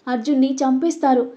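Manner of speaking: ordinary delivery, nothing marked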